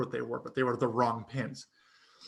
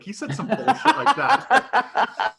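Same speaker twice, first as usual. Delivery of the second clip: high, loud, slow laughing; 10 semitones higher, 7 dB louder, slower than usual. Real, not acted. Friends hearing the story, laughing loudly. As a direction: laugh through the whole line.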